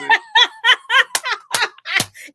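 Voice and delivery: high, bursting belly laugh; big laugh. Real, not acted. Host hearing friend's accent joke, big high laugh. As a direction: crack up at the joke.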